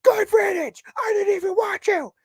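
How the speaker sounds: high, loud hammed-up yell; screeching fake bravado; mock angry shout. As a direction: shout it up high like a spurned lover. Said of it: "And he's like".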